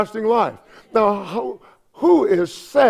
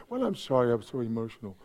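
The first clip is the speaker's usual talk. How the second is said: low, soft mock apology; subdued, sheepish apology; apologetic; 7 semitones lower, 11 dB quieter, faster than usual. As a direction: meekly excuse yourself, almost under your breath. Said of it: "They apologize for being emotional".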